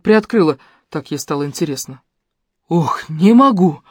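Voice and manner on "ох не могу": slow drawn-out moan of despair; mild moan, slow and dragged. A narrator's reading. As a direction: groan it out slowly, at the end of his rope. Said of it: "простонал нудист".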